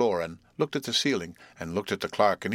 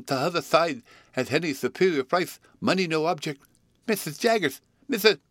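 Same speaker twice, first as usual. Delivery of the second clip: higher, lisping begging voice; desperate suitor; 8 semitones higher than usual. Acted. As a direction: keep pleading, rising in desperation. Suitor begs Jaggers desperately.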